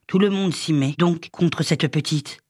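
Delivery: plain reading voice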